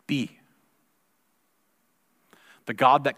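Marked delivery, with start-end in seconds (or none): 0.1-0.4 a single breathy whispered word. Acted. Pastor invites the congregation to simply be, whispered. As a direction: let the word fall to a whisper, letting go.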